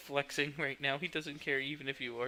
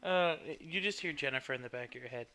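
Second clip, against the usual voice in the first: usual speaking voice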